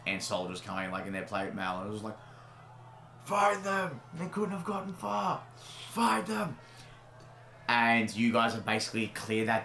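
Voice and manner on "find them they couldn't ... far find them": high, shouted guard orders; guards shouting. Acted. Guards hunting the party, high shouting. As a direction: bark it like a chasing guard.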